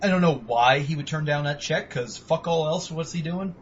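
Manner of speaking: ordinary talk, nothing marked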